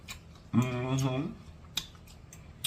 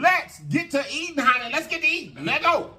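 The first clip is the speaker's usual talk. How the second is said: excited; 12 semitones higher than usual. Real, not acted. Hyped to start eating.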